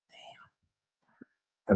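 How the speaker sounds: unvoiced whisper under the breath; whispered damn. Teen whispering after being told to turn the music down. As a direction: whisper it so dad can't hear. Muttered reaction to father.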